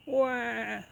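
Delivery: put-on high, wailing baby cry; shrill infant "wah". A narrator's reading. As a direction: wail like a hungry newborn.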